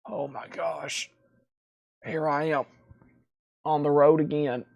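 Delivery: worn-out, out of breath and quiet; puffing after a long stretch. Real, not acted. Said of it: "Let me catch my breath".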